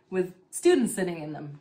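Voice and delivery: ordinary talk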